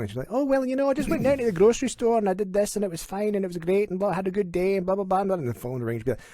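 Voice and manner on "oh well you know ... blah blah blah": high, chatty Scottish mom; mom talking normally. Retold or imitated. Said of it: "she's talking to us normally".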